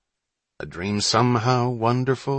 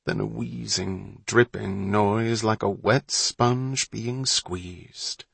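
ordinary delivery

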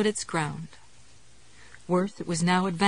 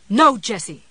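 louder, urgent cry of alarm; 2 semitones higher, 9 dB louder than usual. Acted. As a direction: call out in sudden alarm. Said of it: "It was Ruth's voice, and it was full of alarm".

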